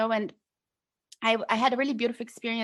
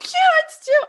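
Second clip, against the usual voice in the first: much higher, loud, laughing squeal; laughing shock; 9 dB louder than usual. Retold or imitated. Shocked at coach hunting.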